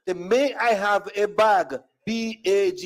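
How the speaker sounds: word by word, then spelt out; slow, careful repeat. Retold or imitated. Customer spelling it for store lady, slow careful repeat. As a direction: enunciate each word slowly for the listener.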